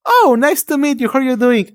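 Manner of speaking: chirpy, welcoming reply; friendly stranger. Retold or imitated. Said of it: "And they were like".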